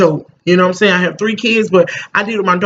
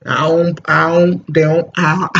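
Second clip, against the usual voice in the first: normal talking voice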